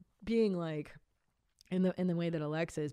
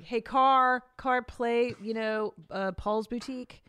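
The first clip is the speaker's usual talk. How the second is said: mock car command; 4 semitones higher, 6 dB louder, slower than usual. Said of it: "I could just be like".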